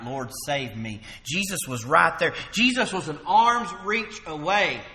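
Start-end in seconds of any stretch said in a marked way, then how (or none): 0.0-1.0 slow, soft pleading; a hushed, drawn-out plea; quiet plea. Retold or imitated. Preacher retelling Peter's plea, slow and soft. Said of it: "he cried out".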